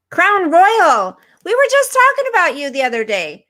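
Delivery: high, loud delighted hello; high, loud welcome to a long-missed regular; excited greeting. Real, not acted. Greeting a returning viewer excitedly.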